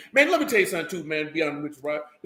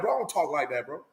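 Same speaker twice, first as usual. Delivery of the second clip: ordinary delivery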